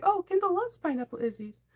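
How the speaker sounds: high, surprised little gasp of delight; surprised exclaim. Retold or imitated. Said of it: "I sound like Dorothy from Wizard of Oz".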